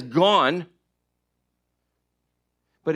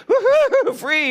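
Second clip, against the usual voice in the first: high, loud whoop of freedom; shrieky, playful "woo-hoo" celebration; gleeful whoop; 7 dB louder than usual. Acted. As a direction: whoop like a dog bolting out the door. Voicing a dog escaping outdoors.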